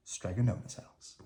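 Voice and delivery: ordinary delivery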